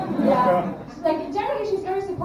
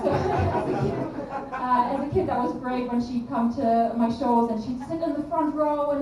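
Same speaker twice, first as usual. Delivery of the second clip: normal talking voice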